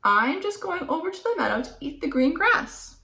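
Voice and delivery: high little goat voice; small and polite, a little goat explaining; tiny goat explaining himself to the troll. Acted.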